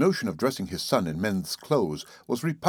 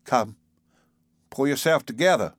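commanding; 5 semitones higher than usual. A narrator's reading. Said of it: "commanded the nurse".

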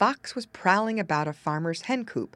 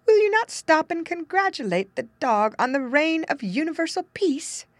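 high-pitched storybook character voice; questioning rooster; 8 semitones higher, 4 dB louder than usual. Acted. Rooster urging the departing fox to stop, high-pitched questioning. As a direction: pitch it high, as an acted appeal.

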